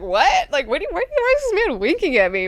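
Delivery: high, rising confusion; baffled. Retold or imitated. Idaho baffled that Garen winks at him. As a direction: squeak it out, totally thrown.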